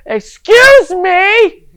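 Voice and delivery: an indignant yell, stretched long; retold loud excuse me. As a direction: bellow it slowly at the rude lady. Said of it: "And I go".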